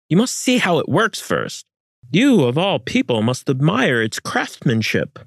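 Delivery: high, keen persuading voice; eager urging. Acted. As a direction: coax him with eager pride. Francisco urging Almagro, voiced high.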